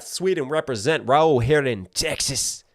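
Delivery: ordinary talk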